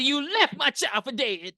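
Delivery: high, indignant accusation; mock outrage. Berating the girl who left the janitor, high mock outrage. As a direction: wail it like a wronged parent.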